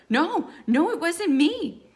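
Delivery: high, loud frightened protest; panicked denial. A narrator's reading. Cornered victim denies to attacker.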